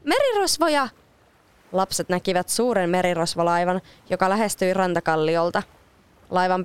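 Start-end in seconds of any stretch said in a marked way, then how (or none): 0.0-0.9 very high frightened mermaid cry; panicked cry. Acted. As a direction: shriek for help at the pirates. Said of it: "merenneitojen hätääntynyt huuto".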